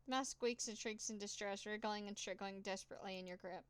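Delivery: normal talking voice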